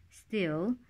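ordinary delivery, nothing marked

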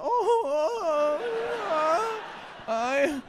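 high, drawn-out wailing sobs; hammed-up crybaby moan; mock crying.

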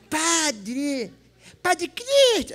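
high, drawn-out put-on woman's call; woman calling the priest. Woman approaches the priest for attention, high comic call. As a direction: call out "Padre" in a mock female voice. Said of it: "chegou perto de mim uma mulher".

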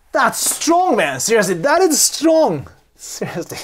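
heated, emphatic amazement; emphatic reaction. Real, not acted. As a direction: react as if floored by it. Reacts to the blunt refusal.

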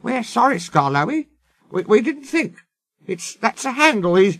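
high, timid apology; small, high, cowed voice; meek quaver. Acted. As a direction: squeak out nervous excuses. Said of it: "quavered the coaches meekly".

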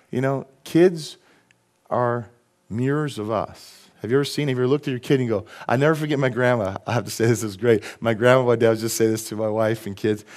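ordinary talk, nothing marked

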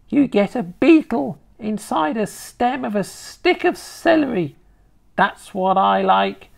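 high, gloating grandma voice; relishing old grandma. Acted. Grandma describing beetles to her grandson, relishing. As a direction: savour the tale as a high-voiced old woman.

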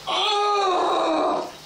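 high startled yelp; shocked yelp. Acted. Shock at the toilet.

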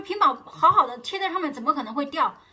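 high, loud mock indignant husband; husband arguing. Husband insisting the screen protector didn't fall off, mock loud. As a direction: throw the accusation back, loud and high.

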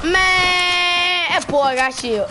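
high, loud outraged shout; frustrated yell. Real, not acted. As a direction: yell it in disbelief at the screen. Frustrated gamer yelling at opponent.

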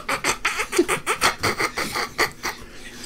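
high breathless giggle; cracking up. Acted. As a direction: burst into helpless laughter. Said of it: "Eric starts cracking up".

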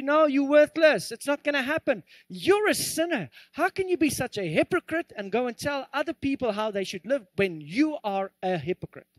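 accusing enemy. Acted. The enemy telling a believer they're worthless, accusing. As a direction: lightly act out a scolding tempter. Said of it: "the enemy comes and he says to you".

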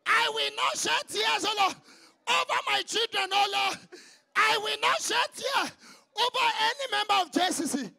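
fervent shouted prayer with long held Oh Lords; a pastor leading the congregation in loud prayer; preaching prayer. Acted. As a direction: cry out each plea to God.

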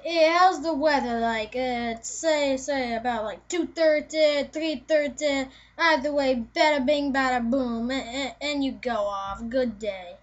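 put-on amateur Italian dude accent. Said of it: "And an Italian".

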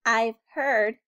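plain reading voice

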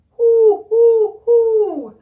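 loud high mock owl hoos; owl hoot. Teacher hooting an owl hint for children. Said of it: "show you the sound".

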